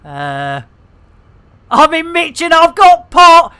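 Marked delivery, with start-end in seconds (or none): 1.7-3.5 loud, higher triumphant crow; gleeful shout. Acted. Reading a player's bragging message, loud gleeful shout. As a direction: show off the win.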